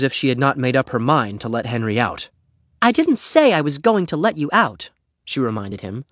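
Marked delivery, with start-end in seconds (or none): 2.8-4.9 put-on high girl's voice, pointed reminder; withholding girl. A narrator's reading. Beezus reminds trapped Henry she promised nothing, pointed.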